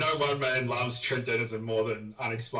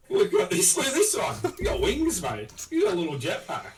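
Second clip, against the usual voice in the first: much higher, louder, laughing delight; giddy excitement; 10 semitones higher, 5 dB louder than usual. Real, not acted. Showing his mate a winged figure, laughing squeal.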